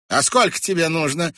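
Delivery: high, loud astonished question; surprised. A narrator's reading. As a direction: ask in mild disbelief. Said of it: "удивилась женщина".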